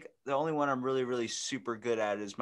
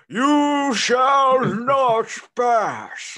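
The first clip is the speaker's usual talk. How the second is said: slow booming wizard shout, much higher and louder; Gandalf bravado; 13 semitones higher, 11 dB louder, much slower than usual. Retold or imitated. Podcast host shows a D&D wizard, slow booming shout. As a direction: bellow it like a wizard blocking the bridge. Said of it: "you need this whole, like, bravado".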